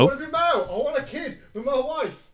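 high loud desperate shout. Acted. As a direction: yell it like a plea. Dramatic outburst wanting to be male.

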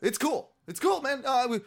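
high, repeated reassurance; imitated placating. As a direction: play a stranger backing off and soothing. Voices the strangers calming him down.

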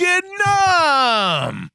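loud, high, drawn-out exclamation; astonished shout. Real, not acted. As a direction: bellow the country name in disbelief. Astonished echo of the country name.

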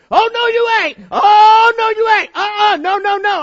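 high, very loud shrieking mother; scolding mama. Retold or imitated. Mama stopping muddy son at door, shrieking refusal. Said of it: "She come flying out that back door".

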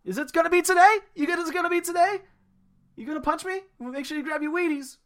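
high put-on comic dad voice; imitating the skit. Retelling the beer sketch to a friend, high put-on comic voice. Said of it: "Samuel Adams Oktoberfest beer skit".

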